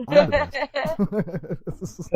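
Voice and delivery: drawn-out, high, self-satisfied brag; smug boast. Pretend. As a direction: gloat like you just won everything. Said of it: "Ego boost in five".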